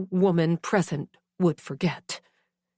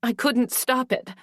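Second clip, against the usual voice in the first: usual speaking voice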